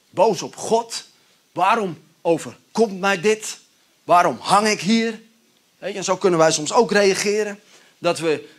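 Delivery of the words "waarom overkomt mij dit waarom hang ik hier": angry. Acted. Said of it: "die is gewoon boos".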